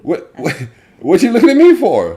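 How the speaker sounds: very high, loud mock protest; mock indignant outburst. Caught out at the dinner table, mock indignant yell. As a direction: yell it in hammed-up outrage. Acting out a defensive family member.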